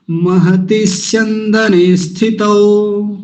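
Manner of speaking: महति स्यंदने स्थितौ in one slow flow; whole line joined. Shown as a demonstration. Teacher reciting the full line joined. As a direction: chant the whole line without breaking. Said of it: "यहाँ से यहाँ तक कैसे बोलेंगे".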